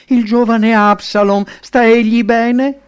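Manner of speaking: high, loud anxious plea, drawn out at the end; worried question. A narrator's reading. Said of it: "pronunciò con preoccupazione la domanda". (domanda preoccupata, voce alta, finale ascendente)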